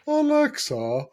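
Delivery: high put-on posh call. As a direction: summon the speaker like a grand lady. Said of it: "he talks to it in a posh voice".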